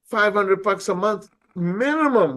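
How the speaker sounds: worked-up disbelief. Retold or imitated. Lecturing a friend about his huge bill, worked up. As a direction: hammer home how big the bill must be. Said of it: "I'm like".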